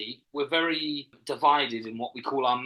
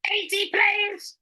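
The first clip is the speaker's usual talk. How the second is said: high, slow robotic shriek; Dalek; 5 dB louder, much slower than usual. Shown as a demonstration. Finishing the show title in the impression, robotic shriek. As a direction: screech it out mechanically, loud. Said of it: "I can do a really good Dalek".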